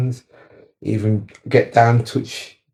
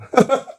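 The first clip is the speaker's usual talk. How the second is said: short high-pitched laugh; a real burst of amused laughter; quick high giggle at a private thought. Real, not acted. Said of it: "I'm laughing 'cause I'm thinking about something".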